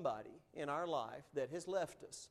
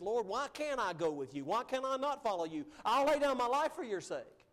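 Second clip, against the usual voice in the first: brash overconfidence; 8 semitones higher, 4 dB louder than usual. Retold or imitated. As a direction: voice climbing high into a loud, bold vow. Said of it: "Peter's brash overconfidence".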